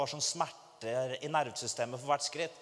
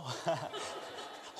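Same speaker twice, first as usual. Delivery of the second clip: soft breathy laugh; 8 dB quieter than usual. Real, not acted. Laughing at own nervousness.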